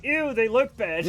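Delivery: loud, drawn-out "ew" of distaste; mock disgust. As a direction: recoil loudly, stretching the words. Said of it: "And I'm like".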